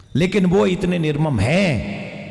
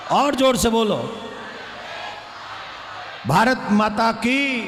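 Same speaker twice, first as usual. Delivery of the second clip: booming political rally speech; 2 semitones higher than usual. Real, not acted. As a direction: lead the crowd in slogans. Leading rally chants.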